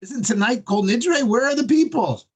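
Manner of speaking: put-on high, pleading Yiddish-accented cry; pleading belt. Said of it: "he belted me".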